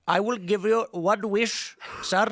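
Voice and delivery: put-on genie with a light Indian accent. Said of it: "Why does he talk like that?".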